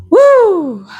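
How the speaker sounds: high, loud, sudden whoop of amazement; excited whoop. Real, not acted. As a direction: let out a burst of a cry, blown away. Overwhelmed by the reading.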